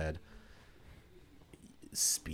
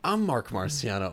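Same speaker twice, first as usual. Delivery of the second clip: higher, louder, slow mock announcement; mock realization; 5 semitones higher, 4 dB louder than usual. Client realizing he is being called, mock realization. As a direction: announce the wrong name slowly as your own.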